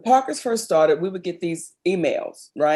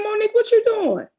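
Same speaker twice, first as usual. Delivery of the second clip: surprised call; 5 dB louder than usual. Retold or imitated. Retelling James Tripp calling out.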